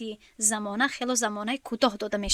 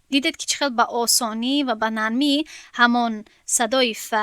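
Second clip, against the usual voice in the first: ordinary talk, nothing marked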